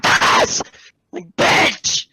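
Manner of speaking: loud, harsh outburst; explosive frustrated yell; exasperated shout. Retold or imitated. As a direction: burst out yelling after the mumble. Exasperated at inaudible customer.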